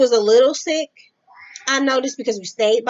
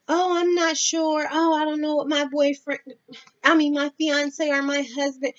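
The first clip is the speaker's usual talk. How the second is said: mock hesitant excuses; 5 semitones higher than usual. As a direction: mimic someone stalling with excuses. Mimics coworker's excuses.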